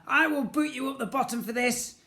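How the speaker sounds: loud, high, furious threat; angry threat. Acted. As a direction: threaten him angrily.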